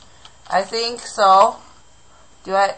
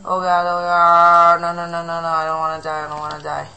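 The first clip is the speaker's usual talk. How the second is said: panicked pleading; 5 dB louder, faster than usual. Real, not acted. Mobs attack during game.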